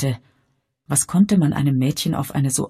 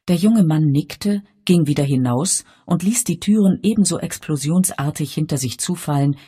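usual speaking voice

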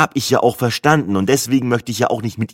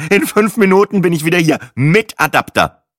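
loud, higher, impatient announcement; snorting; 4 semitones higher, 5 dB louder than usual. A narrator's reading. Traveller hurrying to the door for adapter, snorting. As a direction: huff it out, hammering the last words. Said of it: "schnaubte ich und eilte zur Tür".

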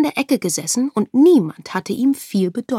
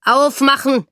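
loud, higher barked order; pounding command; 5 semitones higher, 7 dB louder than usual. Acted. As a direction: bang on the door with it. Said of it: "polterte Esther schon an der Tür".

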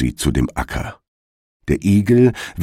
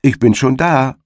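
spöttischer Zuruf; 8 semitones higher than usual. A narrator's reading. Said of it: "sagten der Igel oder seine Frau".

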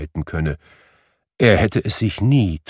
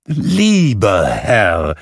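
warm greeting; 5 dB louder, much slower than usual. Acted. Scrooge hails the gentleman.

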